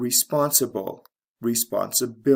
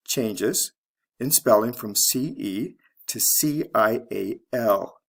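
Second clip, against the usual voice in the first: usual speaking voice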